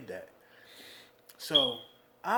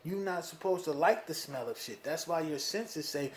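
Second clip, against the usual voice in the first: ordinary talk, nothing marked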